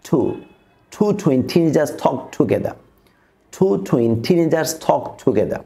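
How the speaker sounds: put-on slow tongue twister, puff of air on t in two, twin, teenagers, talk; measured delivery, aspirated t in two, talk, together; aspirated t drill. Shown as a demonstration. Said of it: "তিনটা সাউন্ডসকে আমরা এভাবে".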